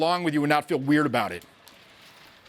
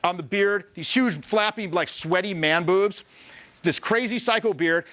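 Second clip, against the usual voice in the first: usual speaking voice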